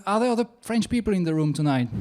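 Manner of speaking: rattled off fast to get past the bad line; rushed retake. Shown as a demonstration. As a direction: hurry through it, a little embarrassed. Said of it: "Who wrote that horrible script".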